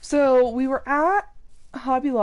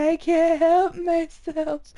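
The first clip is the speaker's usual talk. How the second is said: higher, slower mock whimper; mock pleading pig; 6 semitones higher, slower than usual. Pig voiced as a helpless bug, mock whimper. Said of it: "He's like".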